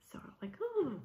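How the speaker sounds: swooning whisper. Real, not acted. Swooning over romantic line.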